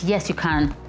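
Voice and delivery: normal talking voice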